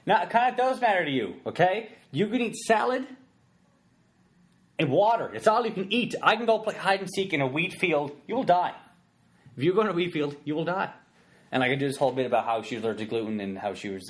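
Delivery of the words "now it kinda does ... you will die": mock rant. Ranting at the girlfriend.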